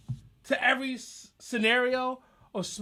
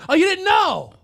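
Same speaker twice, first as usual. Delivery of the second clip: high, loud mock disbelief; loud, swooping rhetorical jab; taunting shout; 10 semitones higher, 8 dB louder than usual. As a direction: holler it like a taunt. Taunting the audience in rant.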